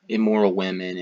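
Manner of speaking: ordinary delivery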